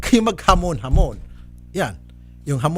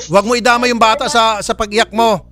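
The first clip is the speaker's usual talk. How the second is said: raised, higher, forceful voice; stern scolding; 6 semitones higher, 4 dB louder than usual. Real, not acted. Host warns mother about the child, scolding. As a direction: scold her loudly and insistently.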